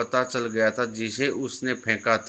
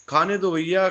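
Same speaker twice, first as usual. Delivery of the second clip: higher, urgent begging plea; frightened pleading; 5 semitones higher than usual. Acted. Frightened boy begging Sonu at his feet, higher pleading. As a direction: beg him, scared, to let you eat. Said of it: "बच्चा डर गया और सोनू के पाँव में आकर गिर गया".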